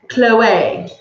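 Chloe said as KLOH-ay. Shown as a demonstration. Telling viewers how to say the name, Chloe as KLOH-ay. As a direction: stress the last syllable, Chloe ending in ay. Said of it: "It's Chloe, not Chloe".

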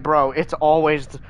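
ordinary talk, nothing marked

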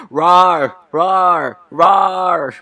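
spoken roar, high and loud; hammed-up bear roaring; bear's roar. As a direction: shout the word like a roaring bear. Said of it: "The bear lunges at him and roars".

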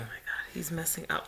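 hushed whisper under the breath. Real, not acted. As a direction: whisper it so he can't hear. Whispering while boyfriend interrupts.

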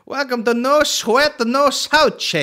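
high-pitched Italian-style imitation; mock Italian accent. Mimicking the man's t-shirt slogan, mock Italian accent.

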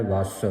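ਵਸ with a tight doubled s, vass; tightly held ਵਸ; tight pronunciation. Shown as a demonstration. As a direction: press the final ਸ of ਵਸ. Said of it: "ਇਹ ਕੱਸ ਕੇ ਬੋਲਣਾ ਹੈ".

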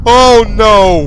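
high loud panicked yelp; panicked cry. Real, not acted. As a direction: yelp in real fright. Chased in horror game.

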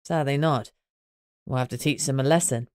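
low, menacing male voice; ominous. Acted. Greg threatening the invading wolves, low and ominous. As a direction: promise a lesson darkly. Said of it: "he said ominously".

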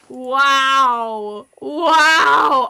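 loud disbelieving wail at himself; a loud, drawn-out cry of self-disgust; exasperated wow. Real, not acted. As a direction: howl it out in real frustration. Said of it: "I do not think outside the box".

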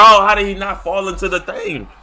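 ordinary talk

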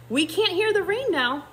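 high, mildly shouted storybook line; shouting. A narrator's reading. Baboons announcing the rain has gone, mildly shouting. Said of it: "shouted the baboons".

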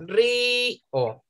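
Río in two drawn-out beats, RÍ - O; split into syllables. Shown as a demonstration. Teacher counting two syllables for pupils, split into syllables. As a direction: stretch the RÍ, then a separate O. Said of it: "tiene dos sílabas".

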